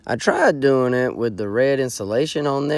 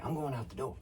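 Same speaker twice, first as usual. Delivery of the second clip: whispering; 16 dB quieter, faster than usual. Acted. Whispered argument in blind.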